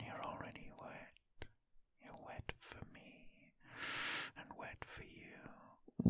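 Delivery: soft whisper fading almost to nothing. Acted. Host whispering to her aroused listener, fading whisper.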